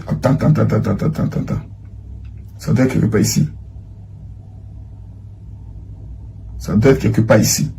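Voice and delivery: low, soft mumbling to oneself; distracted muttering. Real, not acted. Host stalling while he scrolls for the message, muttering quietly. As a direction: murmur absently while you search.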